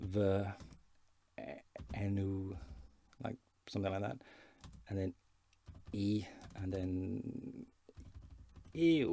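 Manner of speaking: "Fy enw" "i" "ew" sounded out slowly; spelled out sounds. Shown as a demonstration. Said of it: "would probably phonetically be spelt".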